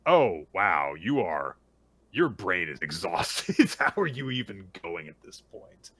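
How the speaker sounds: loud, amazed exclamation; acted astonishment. Acupuncturist examining an exhausted client, loudly astonished. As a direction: play amazed concern, pushing the key words.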